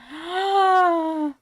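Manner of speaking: long, high loud gasp; shocked gasp. Real, not acted. Streamer reacts to claws sinking in, shocked gasp.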